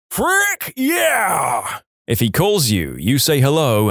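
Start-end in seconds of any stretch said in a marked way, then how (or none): 0.1-1.8 high, exuberant drawn-out holler; exuberant shout. Acted. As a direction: announce yourself with a whooping yell. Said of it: "with an exuberant".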